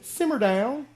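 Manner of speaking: very high, loud, rising put-down; hammed-up order to quieten down; exaggerated telling-off. Crowd hushing Bartimaeus in Southern phrase, high exaggerated scolding. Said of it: "That's a good one in the South".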